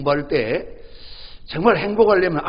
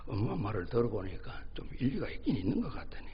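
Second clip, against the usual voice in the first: ordinary talk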